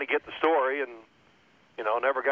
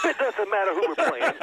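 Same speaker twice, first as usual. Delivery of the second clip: laughing while talking; 11 semitones higher than usual. Retold or imitated. Punchline, laughing while quoting.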